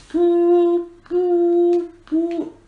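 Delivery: high, loud held siren hoots; imitated siren. Game master makes the town alarm sound, high siren hooting. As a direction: wail like a warning siren on one note. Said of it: "you hear this sound siren going".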